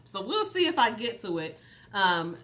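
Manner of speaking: normal talking voice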